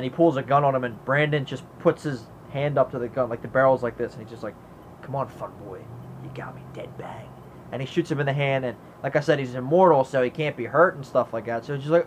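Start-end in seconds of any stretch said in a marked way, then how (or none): 5.0-7.3 low, very soft imitation trailing into whisper; hushed dare. The crow dares the gunman, imitated low hushed taunt. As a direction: goad him under your breath.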